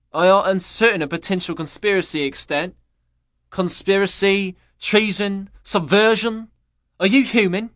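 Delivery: stiff questioning that climbs; rising interrogation. Acted. Machine-like interrogator escalates.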